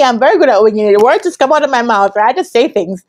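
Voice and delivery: normal talking voice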